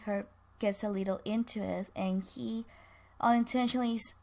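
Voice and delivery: ordinary delivery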